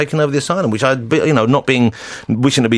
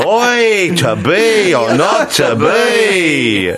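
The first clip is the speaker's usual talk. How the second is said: very slow, hammed-up fake Cockney; 7 semitones higher, much slower than usual.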